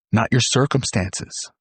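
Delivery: ordinary talk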